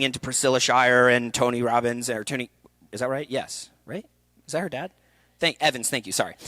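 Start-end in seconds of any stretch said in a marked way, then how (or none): none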